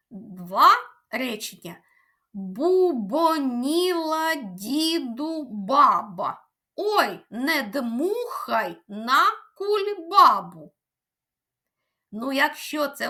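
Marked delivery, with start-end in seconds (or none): 2.3-10.7 slow, careful tongue twister; each syllable spelled out slowly; slow and clear. Shown as a demonstration. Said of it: "спробуємо разом повторити". (повільно й чітко)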